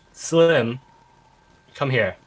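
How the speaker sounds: mild in-character call to a companion; calling over. Acted. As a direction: lift the name to summon him. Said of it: "will call over to Slim".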